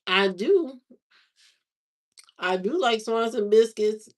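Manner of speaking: usual speaking voice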